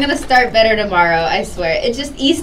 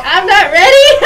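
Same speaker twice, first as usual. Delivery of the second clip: higher, louder, bursting into laughter; laughing protest; 12 semitones higher, 4 dB louder than usual. Real, not acted. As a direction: protest loudly as the giggles take over. Protest repeated, breaking into laughter.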